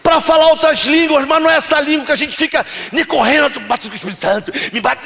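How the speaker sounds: high, loud, fervent exhortation; full-force preaching. Real, not acted. Preacher urging the church to carry the message, fervent shouting. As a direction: raise the voice and cry out to the congregation.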